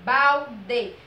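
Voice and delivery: BALDE split into two beats, BAL and DE; syllable by syllable. Shown as a demonstration. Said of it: "a gente abriu a boca duas vezes".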